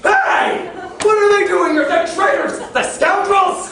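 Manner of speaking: high, very loud indignant outburst; outraged shouting. Acted. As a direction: explode at the traitors. Outraged at seeing niece and companion.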